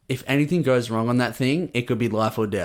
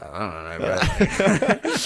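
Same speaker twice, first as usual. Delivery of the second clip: slow, bemused laughing shrug; much slower than usual. Retold or imitated. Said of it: "I was like".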